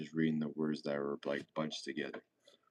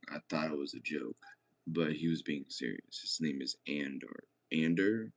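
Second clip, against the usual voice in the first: ordinary delivery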